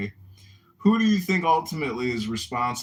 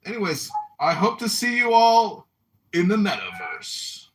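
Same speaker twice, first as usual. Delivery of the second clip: high, loud sci-fi persona sign-off; Lawnmower Man voice; 10 semitones higher, 7 dB louder than usual. Pretend. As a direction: ham up a cyber-guru farewell. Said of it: "I'm prepared to go full lawnmower man".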